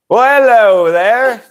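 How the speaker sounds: high, loud, slow mock-gallant hello; awkward greeting. Vlogger retelling Alex greeting the wrong person, hammed-up and loud. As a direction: ham up a stranger's stiff greeting. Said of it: "And then Alex goes".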